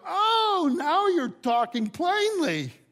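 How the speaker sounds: hammed-up dawning relief; mock relieved surprise. Disciples finally getting what Jesus said, mock relieved.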